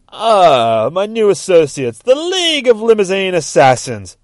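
high, loud theatrical announcement; gleeful villain welcome. Acted. Judge greeting his new assassins, gleeful loud announcement. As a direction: proclaim your henchmen grandly.

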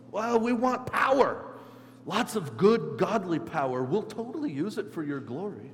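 high, loud, over-keen pitch for power; eager disciples. Acted. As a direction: play the disciples eagerly begging Jesus. Disciples excitedly asking for power, acted out.

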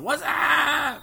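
high, stretched Whassup call; Bud Light What's up. Retold or imitated. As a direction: mimic the famous beer ad greeting, drawn out high. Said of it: "everyone was, like, going".

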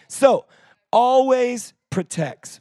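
a preacher calling scripture out to the room; preaching at full force. Acted. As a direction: proclaim it boldly.

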